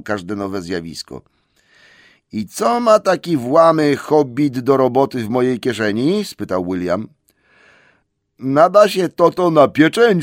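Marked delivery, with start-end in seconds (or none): 2.3-6.2 troll voice. Acted. Troll William questions. 8.4-10.2 high, loud greedy troll question; hungry troll. Acted. Troll Tom asks about roasting, high and loud.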